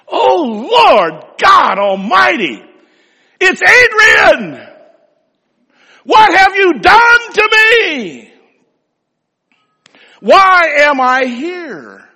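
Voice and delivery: high, slow, shouting anguish; anguished cry to God. Retold or imitated. Pastor alone in a field crying out to God, high slow shout. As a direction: hurl each word up to heaven, drawn out. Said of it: "he cried out unto God".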